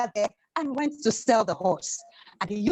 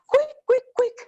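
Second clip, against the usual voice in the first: high, loud quick quick quick; storyteller urging the rat on; excited urging; 11 semitones higher, 4 dB louder than usual. A narrator's reading. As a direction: hurry it along, breathless and high. Narrator urges rat's dash.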